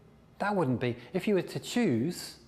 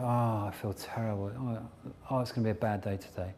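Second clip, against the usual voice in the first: lower, groaning self-pity; mock morning gloom; 4 semitones lower than usual. Coach acts out waking up feeling terrible, low gloomy moan.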